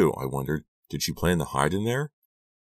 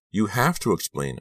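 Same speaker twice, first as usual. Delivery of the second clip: crying out; 6 semitones higher than usual. A narrator's reading. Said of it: "I cried".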